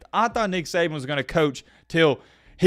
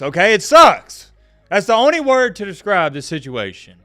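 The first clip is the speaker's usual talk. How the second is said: loud, high, exasperated insistence; upset outburst; 6 semitones higher, 9 dB louder than usual. Real, not acted. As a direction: burst out with the hurt. Upset outburst over coach retiring.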